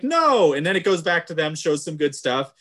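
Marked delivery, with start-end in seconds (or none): 0.0-0.5 high, loud dramatic no; mock villain no. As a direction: yell it like a thwarted villain. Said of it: "is like".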